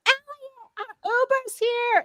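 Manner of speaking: very high, drawn-out call of a name and news; imitated yelled call. Girlfriend calling Eliot to the waiting Uber, high drawn-out yell. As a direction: sing out the name across the room, mocking her. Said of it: "she's, like, yelling it".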